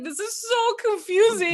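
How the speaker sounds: high, squeaky confused complaint; baffled whine. Retold or imitated. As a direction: play dumb and exasperated. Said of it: "I'm like".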